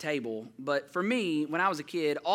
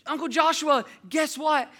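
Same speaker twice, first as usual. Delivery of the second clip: high, loud child imitation; bursting to share news like a small kid; pitched-up little girl voice; excited three-year-old; 10 semitones higher, 7 dB louder than usual. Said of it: "She's three".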